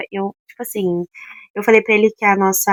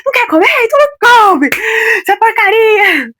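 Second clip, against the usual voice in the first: high, loud angry shouting; acted-out yell at the top of the voice; furious outburst; 12 semitones higher, 7 dB louder than usual. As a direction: explode in exasperation, high and loud. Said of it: "eu tava puta na hora".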